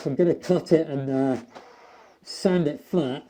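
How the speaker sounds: ordinary talk, nothing marked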